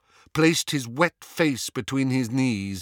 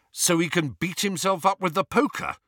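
snorting; 8 semitones higher, faster than usual. Acted. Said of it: "snorted Harry".

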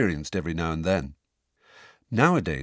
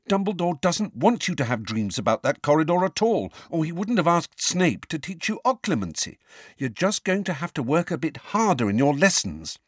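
put-on lecturing girl voice; 7 semitones higher than usual. A narrator's reading. Hermione lectures Harry.

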